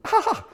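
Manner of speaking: put-on very high gleeful child whoop; boy's whoop. Little boy flying over the fallen tree, high whoop. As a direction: squeal like a kid flying off a bike.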